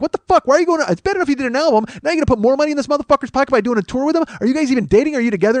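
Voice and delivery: high, rapid-fire exasperated questions; mock outrage. Everybody confronting [name] about the tour, mock outraged squawking. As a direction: imitate a scandalized public nagging her. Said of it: "everybody's gonna be like".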